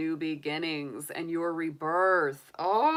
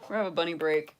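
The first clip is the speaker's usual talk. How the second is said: ordinary talk, nothing marked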